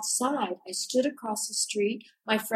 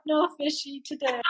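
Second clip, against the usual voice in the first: mock chirpy reply; 6 semitones higher than usual. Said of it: "I'm like".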